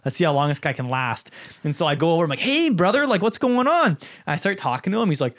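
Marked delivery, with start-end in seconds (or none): 2.4-3.9 mock friendly greeting. Greeting conspiracy guy at Best Buy.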